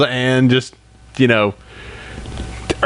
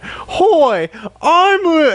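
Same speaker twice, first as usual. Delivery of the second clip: a hint of a squeaky English colt, much higher; Pipsqueak's mock accent; much slower than usual. A fan imitating Pipsqueak's odd English accent, pitched high. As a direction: lightly imitate the colt's shaky English accent. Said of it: "what accent is that?".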